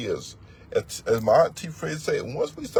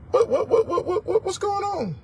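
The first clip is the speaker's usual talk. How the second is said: much higher, incredulous repeats; mock disbelief. Preacher scoffing at brothers bypassing the law, high incredulous. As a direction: climb up in fake astonishment.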